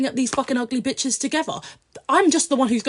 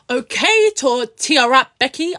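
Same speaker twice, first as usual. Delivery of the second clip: mocking taunt; 3 semitones higher, 8 dB louder, slower than usual. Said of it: "And I was like".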